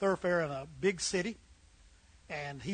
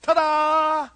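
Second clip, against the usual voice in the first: high, loud, drawn-out fanfare; proud ta-da; 8 semitones higher, 7 dB louder than usual. Acted. Son showing dad the painted bathroom, proud ta-da. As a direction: present it with a big flourish. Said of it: "and the son goes".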